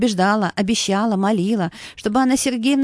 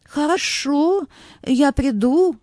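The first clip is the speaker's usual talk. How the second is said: slow, drawn-out and higher, pleased to be asked; flattered agreement; 6 semitones higher, much slower than usual. Acted. Elderly woman accepting a stranger's job offer, flattered. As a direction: savour the offer, stretching the first word. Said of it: "польщённая предложением незнакомки".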